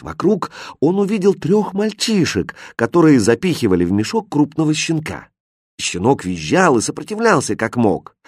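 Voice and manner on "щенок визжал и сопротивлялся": ordinary delivery, nothing marked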